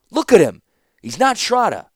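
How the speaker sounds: high, loud, desperate; high, loud frantic protest; frantic insisting. A narrator's reading. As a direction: make her see, frantic. Distraught man insists loudly.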